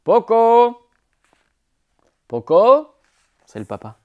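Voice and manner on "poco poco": loud high call across the woods. A narrator's reading. Father calling Poco from the house, loud. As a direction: shout the name far. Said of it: "appela son père".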